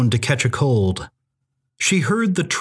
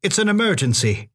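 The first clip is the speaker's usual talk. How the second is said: quick, higher-pitched insistence; urgent command; 6 semitones higher, faster than usual. A narrator's reading. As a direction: press the emergency on him quickly. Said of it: "Elizabeth commanded".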